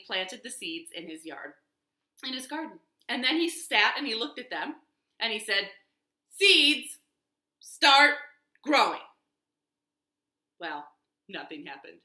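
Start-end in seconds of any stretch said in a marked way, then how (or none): 6.3-9.0 loud, firm word-by-word order; loud command. A narrator's reading. Toad commanding his new seeds, loud command. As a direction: order it loudly, pausing between words. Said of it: "So Toad said it louder".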